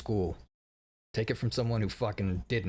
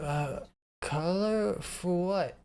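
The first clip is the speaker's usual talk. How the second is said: slow, higher, woozy half-drugged reply; groggy, drugged; 6 semitones higher, much slower than usual. Retold or imitated. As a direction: drag it out like you're going under. Half drugged answering staff.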